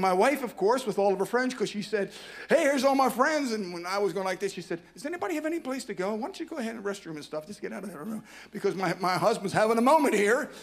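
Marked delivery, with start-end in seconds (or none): 2.5-3.5 higher, louder cheerful call; wife greeting her friends. Retold or imitated. 4.9-8.2 higher and fast, fading softer; wife clearing the room for her husband; wife shooing people out. Retold or imitated.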